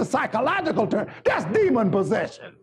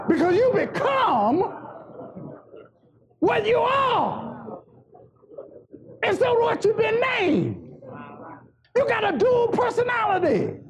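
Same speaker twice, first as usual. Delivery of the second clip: full-force preaching; 8 semitones higher than usual. Acted. Preacher driving home a point to the congregation, full-force preaching. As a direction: preach it out in big high phrases.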